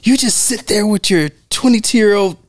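high, sneering imitation; lightly voiced snide diva; hammed-up bitchy jab; mock catty delivery. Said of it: "How she delivers her lines".

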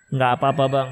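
low, reluctant brush-off as the wife; evasive wife. Acted. Istri mengelak saat ditanya suami. (istri mengelak dengan suara rendah)

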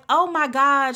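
retold amazement. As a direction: replay her stunned gasp of joy. Stranger overwhelmed by the compliment.

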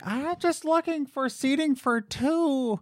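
high, slow mock lady voice; hammed-up entitled customer. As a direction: drag it out in a high put-on voice.

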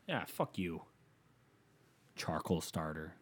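ordinary delivery

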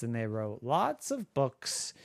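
ordinary delivery

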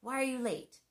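sharp worked-up interrogation; attacking demand. Retold or imitated. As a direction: confront him as he walks in. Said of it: "I may attack and say".

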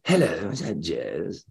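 slow, soft, drawn-out caller; Peter Wyngarde. Retold or imitated. Famous actor phones his fan, honeyed and slow. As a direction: imitate a honeyed, textured actor's voice. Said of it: "And, and this voice said".

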